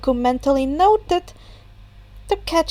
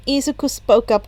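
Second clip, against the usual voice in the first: usual speaking voice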